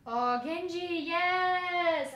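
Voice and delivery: a pleased yes; excited. Real, not acted. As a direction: glad cheer over a needed card. Happy at pulling Genji.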